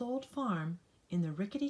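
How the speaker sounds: ordinary delivery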